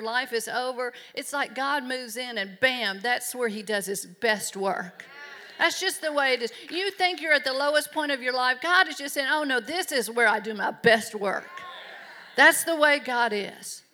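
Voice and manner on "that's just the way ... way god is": loud, rising sermon declaration; louder, ringing pulpit delivery; full-force preaching. Acted. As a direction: preach it out to the whole room.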